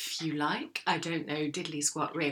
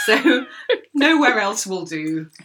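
laughing; 4 semitones higher, 10 dB louder, slower than usual. Real, not acted. Laughing about the clingy dog.

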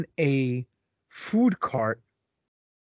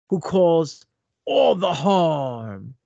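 ordinary talk, nothing marked

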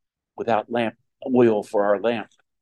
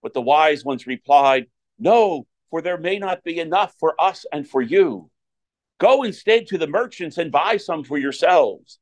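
solemn proclaimed scripture reading; Gospel reading; 4 semitones higher than usual. Acted. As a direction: read it out like a lector at Mass.

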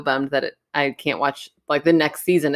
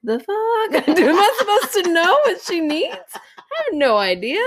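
high, louder, disbelieving laugh-out; mock-horrified new mom thinking to herself; shocked inner voice; 13 semitones higher, 4 dB louder than usual. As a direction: blurt your stunned panic with a laugh. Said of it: "And I thought".

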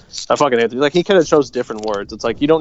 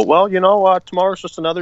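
higher-pitched take on a droning former manager; Mike Matheny; 7 semitones higher, faster than usual. Acted. Host mocking his team's former manager, higher-pitched impression. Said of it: "our former manager, Mike Matheny, who would just go".

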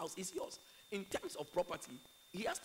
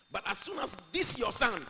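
loud, higher disdainful son; derogatory; 5 semitones higher, 9 dB louder than usual. Retold or imitated. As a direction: throw scorn on THIS YOUR SON. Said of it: "talking in a derogatory way".

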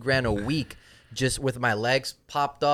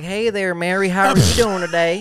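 bright higher salesman greeting; friendly phone voice; 6 semitones higher, 6 dB louder than usual. Retold or imitated. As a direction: put on a sweet sales voice. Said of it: "I would have every kind of voice".

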